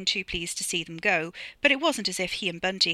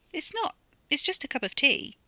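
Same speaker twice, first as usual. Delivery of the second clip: Carrie's voice; 9 semitones higher than usual. Acted.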